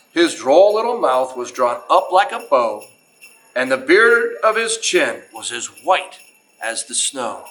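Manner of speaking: put-on reading a Christmas poem aloud to children, lilting; storyteller voice, unhurried and sing-song; story narration. Museum host reads to the kids in a storyteller voice.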